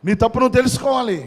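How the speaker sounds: stern preacher's warning to the congregation. Real, not acted. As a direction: speak harshly, like a preacher scolding. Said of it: "కొంచెం కఠినంగా మాట్లాడతాను".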